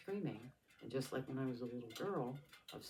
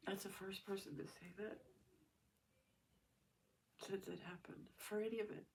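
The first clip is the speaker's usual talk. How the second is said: crying; 8 semitones higher than usual. Real, not acted. Breaking down after apology.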